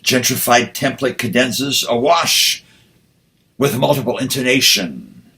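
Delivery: measured, deliberate reading with rising lines; poetry recitation. Acted. Poet reading his poem aloud to the audience, measured recitation. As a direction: recite it like a poet at a reading, savoring each phrase.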